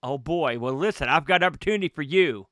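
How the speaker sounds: normal talking voice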